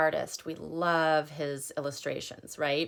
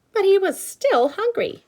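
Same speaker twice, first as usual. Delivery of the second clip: refrain up high; 8 dB louder than usual. Acted.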